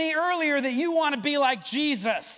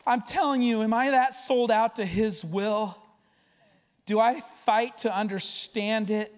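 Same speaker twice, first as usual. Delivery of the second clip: ordinary talk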